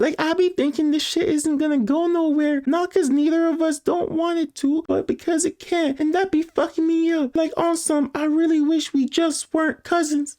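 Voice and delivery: high-pitched cousin voice; cousin texting her crush. Acted. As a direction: read it in a high, put-on voice.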